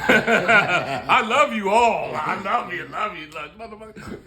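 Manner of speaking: hammed-up preacher before the collection plate; mock preacher. Preacher gushing love at the flock before collection, mock sermon drawl. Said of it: "You might hear your preacher".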